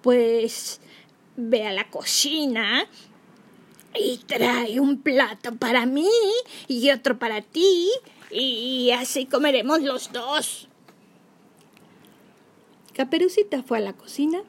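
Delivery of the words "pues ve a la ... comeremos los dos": slow fake granny voice; wolf pretending to be sweet granny. A narrator's reading. Wolf as grandma sends her.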